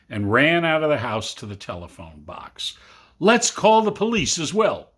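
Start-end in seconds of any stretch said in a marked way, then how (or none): 3.2-4.9 high, louder eager voice; boy keen on calling everyone; excited boy. Acted. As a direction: blurt it out eagerly. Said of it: "Jim said".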